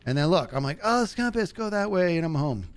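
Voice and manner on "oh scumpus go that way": high coaxing call. Acted. Talking to in-game pet.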